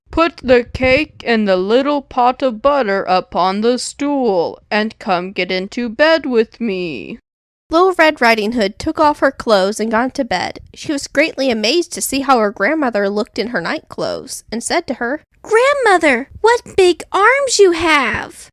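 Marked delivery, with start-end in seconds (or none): 0.1-7.2 put-on a wolf coaxing the girl into bed; luring wolf. A narrator's reading. Wolf voiced in story. 15.4-18.5 put-on high, wondering little girl; a girl marvelling at her granny; the girl in bed asking her grandmother, high and amazed. A narrator's reading. Girl voiced in story.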